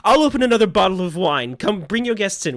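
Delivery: gushing hostess. Acted. Franny welcoming the guests inside. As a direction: play her as a delighted tipsy hostess.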